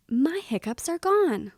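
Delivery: triumphant story character; excited relief. Acted. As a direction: announce it with delighted relief. Henry rejoices, hiccups seem gone.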